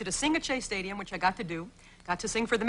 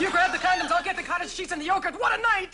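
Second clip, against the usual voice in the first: high, eager mock date talk; mock excited date; 8 semitones higher than usual. Comedian mocking a dating couple with high mock excitement. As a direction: play the thrilled date, hammed-up. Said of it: "it's like".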